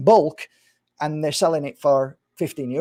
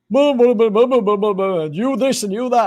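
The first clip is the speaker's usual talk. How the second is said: high, loud mock yammering; a hammed-up impression of a nagging interviewer; mocking blah-blah of the interviewer; 8 semitones higher, 5 dB louder than usual. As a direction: mimic him rattling on, mocking him. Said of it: "So he starts".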